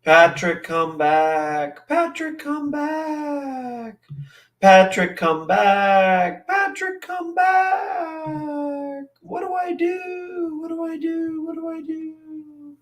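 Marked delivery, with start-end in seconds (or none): 1.9-4.0 mock falsetto wail. Mock calling after Patrick. 6.5-12.8 high, fading falsetto, growing softer; a helpless little high-pitched plea, trailing off; mock falsetto despair. Streamer mocks a lost, panicked voice, high and fading.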